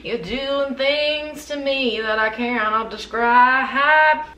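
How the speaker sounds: very slow, drawn-out sultry purr; sultry swoon. Pretend. Reviewer swooning at her bedtime perfume, slow and sultry. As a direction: melt into it, savouring every word.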